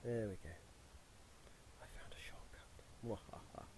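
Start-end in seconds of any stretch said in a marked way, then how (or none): 1.8-3.6 whispered gloating with a mock evil laugh; sneering panto villain. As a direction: hiss it like a gleeful villain.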